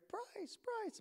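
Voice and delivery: high, soft, faraway call; familiar voice calling. Retold or imitated. Familiar friend's name overheard while lost, high distant calling. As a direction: imitate a name being called from a distance. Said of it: "I hear a familiar voice".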